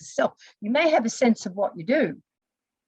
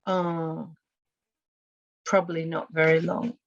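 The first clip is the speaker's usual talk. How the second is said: reluctant admission; 5 semitones lower than usual. Retold or imitated. Client answering coach about self-talk, reluctant admission.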